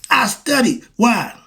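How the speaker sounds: ordinary talk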